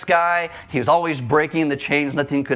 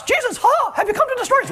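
very high squeaky cartoon mouse; cartoon mouse falsetto, playful; Mickey Mouse voice; faster than usual. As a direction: squeak it like Mickey Mouse asking a demon's question. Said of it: "it could have been like Mickey Mouse".

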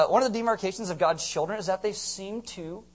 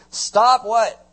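loud, high call on the verse; emphatic call; 5 semitones higher, 9 dB louder, much slower than usual. Shown as a demonstration. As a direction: drive it home loudly to the crowd. Prompting crowd with a verse.

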